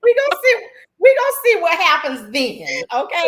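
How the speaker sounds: high, loud words through laughter; laughing. Real, not acted. Laughing hard after the joke.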